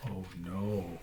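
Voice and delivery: usual speaking voice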